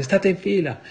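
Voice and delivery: high, loud cry to keep in line; a preacher's shouted command to the marching girls; shouting. Retold or imitated. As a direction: yell the order out sharply. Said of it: "e gridai".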